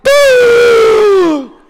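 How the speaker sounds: long, high, loud spluttering blast; imitated kid bursting up for air; drowning gasp noise. Little boy coming out of the water, loud splutter.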